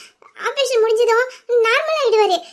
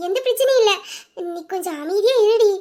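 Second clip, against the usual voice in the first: usual speaking voice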